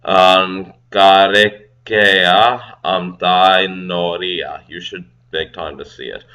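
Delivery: slow, deliberate reading of Kiribati lines; slow careful reading. Shown as a demonstration. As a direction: read each phrase slowly and carefully.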